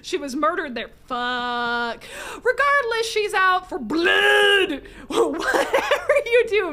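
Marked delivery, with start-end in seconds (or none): 2.4-6.7 big booming announcement; loud, slow, mock-ominous warning; loud dramatic read. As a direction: shout the warning out. Reading game text loudly.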